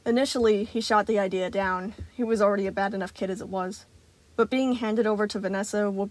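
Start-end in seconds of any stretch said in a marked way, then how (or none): none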